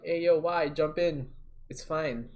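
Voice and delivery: retold holler up from the water; calling out. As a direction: call out to coax a friend in.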